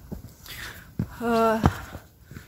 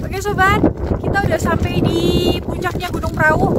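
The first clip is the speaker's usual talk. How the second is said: shouting over wind; 6 semitones higher, 3 dB louder than usual. Real, not acted. Windy foggy summit, strong wind.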